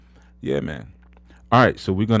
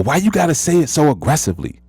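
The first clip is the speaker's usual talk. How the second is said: a hint of a nagging partner, pitched up; mocking partner's complaint; 8 semitones higher, faster than usual. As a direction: lightly imitate the partner's nagging question.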